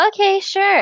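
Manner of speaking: high, small okay sure; a tiny, high-pitched yes to please him; squeaky compliant reply; meek agreeing. Retold or imitated. Her meek answers to husband.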